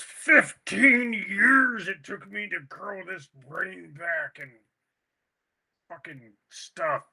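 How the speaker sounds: mock demon growl, drawn out; slow growled imitation of a possessed man; deep growly voice. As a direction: growl it slowly, then let it peter out. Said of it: "growly voice".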